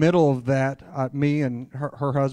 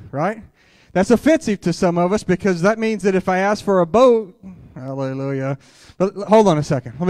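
loud, raised preaching voice; full-force preaching; 5 semitones higher, 6 dB louder than usual. Acted. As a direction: drive the message home to the crowd. Preacher launches into the message.

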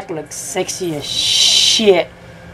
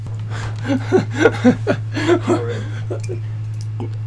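breathy laugh; 6 semitones higher, 6 dB quieter than usual. Real, not acted. Laughs after losing race.

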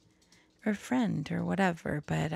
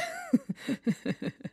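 sudden high giggle; cheeky high-pitched laugh; high squeaky laugh. Real, not acted. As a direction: laugh like you caught yourself. Laughing at own sass.

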